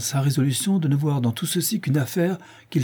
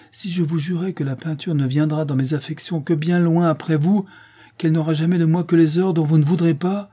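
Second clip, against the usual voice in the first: rising, louder, quicker pleading; 6 semitones higher, 3 dB louder than usual. A narrator's reading. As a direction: beg her, building. Painter pleads desperately.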